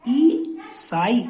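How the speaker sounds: ई साई drawn out slowly, each syllable apart; slow syllable split. Shown as a demonstration. Teacher sounding out ई साई slowly for the class. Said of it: "आई की मात्रा हमारे प्रथम स्थान पर ये आ गई".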